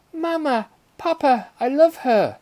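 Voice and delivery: put-on very high, loud girlish exclamation; excited little girl. A narrator's reading. Little girl thanking parents for the kitten, excited exclamation. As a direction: squeal it out as a delighted child. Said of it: "exclaimed Clarissa".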